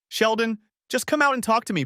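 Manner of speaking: high, urgent, begging voice; high desperate wife begging her husband; pleading wife. A narrator's reading. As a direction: plead through the car window. Wife pleading, banging on window.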